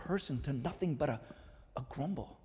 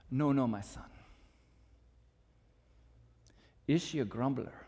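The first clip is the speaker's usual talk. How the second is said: fan the coal with rising fervor, then let it die to dust; Scottish mentor; 8 dB louder than usual. Acted. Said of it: "his mentor".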